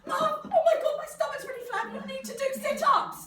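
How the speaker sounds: put-on very high, loud little-child voice; hammed-up small child, shouting to Mum; bossy toddler. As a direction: squeal it like an outraged toddler. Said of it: "two-year-olds or one-year-olds do you know who go".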